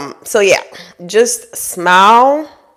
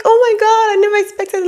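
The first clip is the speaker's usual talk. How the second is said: much higher, gushing mock-thrilled gasp; delighted surprise; 14 semitones higher than usual. Shown as a demonstration. Wife walking into her surprise party, overjoyed. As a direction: play it like the surprise of your life. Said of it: "Just smile like".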